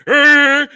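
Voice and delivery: loud hammed-up roar; loud mock roar; mock monster roar. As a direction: bellow back like the Balrog. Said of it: "maybe the Balrog went".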